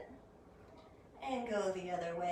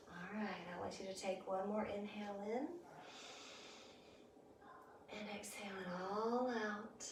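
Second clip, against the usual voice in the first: soft spa whisper; 9 dB quieter than usual. Acted. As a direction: let each cue drift out quietly. Guided breathing during relaxation.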